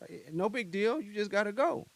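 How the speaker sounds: casual dismissal. Retold or imitated. Guy brushing off the woman he flew out, casually. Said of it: "he's just like".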